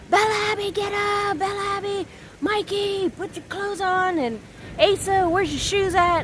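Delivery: put-on high-pitched mom calling the kids; morning yelling imitation. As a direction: re-enact hollering the kids out of bed, pitch way up. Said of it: "yell at them".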